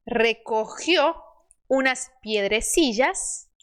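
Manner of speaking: slow, measured Spanish story reading for learners; slow model reading. Shown as a demonstration. Teacher reading the crow fable to learners, slow model reading. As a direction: read each phrase carefully, spacing the words.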